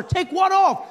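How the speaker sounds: high, exasperated yelled question; bewildered shout. Retold or imitated. Confused passenger to officer, bewildered shout.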